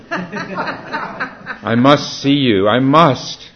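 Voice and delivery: slow, high plea broken by laughter; laughing plea. Acted. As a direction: laugh through the desperate plea. Lover begging at the door.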